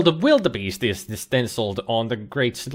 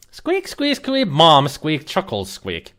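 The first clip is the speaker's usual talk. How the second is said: squeaky ferret; 3 semitones higher, slower than usual. Pretend. Ferret answers about owner.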